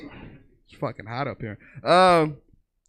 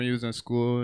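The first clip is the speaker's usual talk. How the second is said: ordinary delivery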